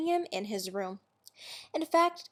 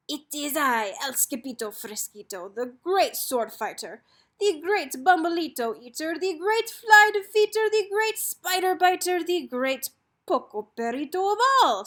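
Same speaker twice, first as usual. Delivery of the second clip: mock-heroic introduction building higher and louder; grand boasting; 5 semitones higher, 5 dB louder than usual. A narrator's reading. Kitten introducing himself as sword fighter, grand boasting. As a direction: announce each title more grandly, climbing in pitch.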